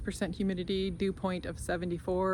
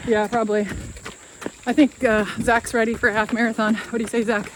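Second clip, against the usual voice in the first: while running; 3 semitones higher, 9 dB louder than usual. Real, not acted. Mid-run update.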